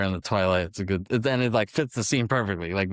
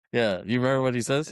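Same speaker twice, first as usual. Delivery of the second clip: normal talking voice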